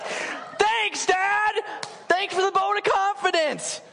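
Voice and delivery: very high, indignant mock yell; hammed-up wounded sarcasm; sarcastic thanks. Mock outrage at dad's harsh verdict.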